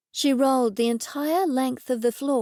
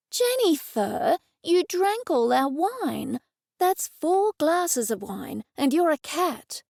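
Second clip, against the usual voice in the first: high, slow scolding; 6 semitones higher, slower than usual. Acted. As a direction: tell off the cat firmly. Scolding the drunk cat.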